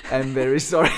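loud apology through real laughter; helpless laughter around the words; laughing apology. Real, not acted. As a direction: apologize while cracking up. Cracking up over lost structure.

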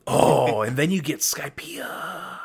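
slow, thrilled whisper; excited whisper. Real, not acted. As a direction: whisper it like a secret treat. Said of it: "Sorry, I'm just excited".

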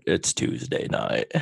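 low slow unvoiced whisper; deep whisper. Joking after mic test.

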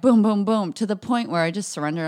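normal talking voice

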